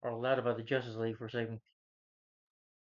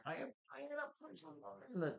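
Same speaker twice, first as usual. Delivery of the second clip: high, soft, eerie other voice; a hint of a demon, much higher and softer; possessed voice; 14 semitones higher, 10 dB quieter than usual. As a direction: let something else speak through her. Demon speaks through Wonder Woman.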